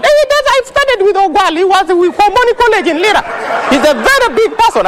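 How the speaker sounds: high-pitched, impressed name-dropping; boastful excited father. Acted. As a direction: brag excitedly about your important old schoolmate. Acts out father boasting of contact.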